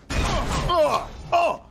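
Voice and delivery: high, startled "Oh!"; real jump-scare yelps; startled cry. Real, not acted. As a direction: yelp in sudden surprise. Startled by the game.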